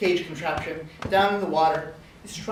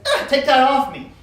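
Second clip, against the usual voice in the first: loud, higher yelp of protest; indignant outcry; 5 semitones higher, 8 dB louder than usual. Acted. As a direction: cry out as if squashed. Rock protests to the farmer.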